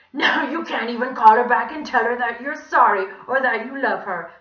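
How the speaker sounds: loud, gloating creepy donkey; terrible villain voice. A narrator's reading. Villain taunting a child who can't call back, gloating. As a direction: gloat loudly in a squawky voice. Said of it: "he said in his terrible voice".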